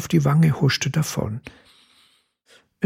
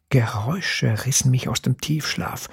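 plain reading voice

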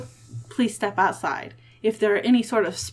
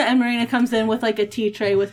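ordinary talk, nothing marked

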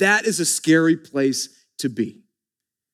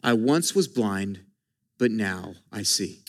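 reverent quote; 10 semitones lower, 7 dB quieter than usual. Shown as a demonstration. Preacher lifting the key verse to the congregation, hushed. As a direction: savour each word like a treasure. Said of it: "the most powerful words in Scripture".

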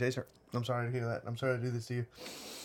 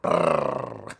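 long trilled R, tongue rolling; rolled r; 5 dB louder than usual. Shown as a demonstration. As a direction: roll the R hard like Spanish. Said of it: "I roll my R's".

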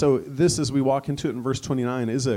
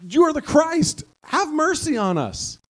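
much higher, loud fervent cry; bold declaration; 4 dB louder than usual. Acted. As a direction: shout out who he is. Blind men declaring Christ.

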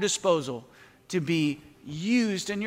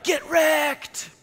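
gamer trash-talk shout; 8 semitones higher than usual. Retold or imitated. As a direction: crow it at the screen like a teen who just won. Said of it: "As my teenage son says when he plays Xbox".

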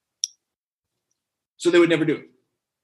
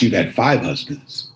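low and gentle; 10 semitones lower than usual. Acted. Jesus leans in to the woman, low gentle voice. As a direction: lean in and tell her gently.

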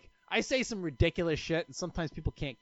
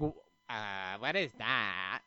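tag; 7 semitones lower, 5 dB quieter, slower than usual. Retold or imitated. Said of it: "an extremely awkward person".

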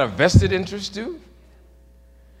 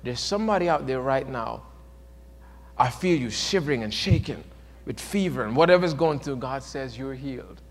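quiet retold preacher; 6 semitones lower, 5 dB quieter than usual. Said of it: "Pat Robinson at that time says".

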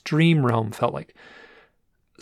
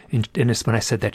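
normal talking voice

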